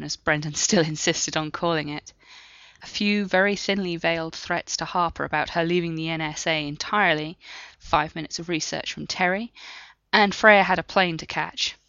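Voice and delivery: usual speaking voice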